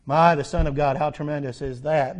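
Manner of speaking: ordinary talk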